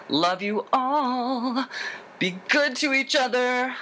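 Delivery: high, drawn-out affectionate farewell; playful, stretched singsong voice; singsong sign-off. Pretend. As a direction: sing out the goodbye up high.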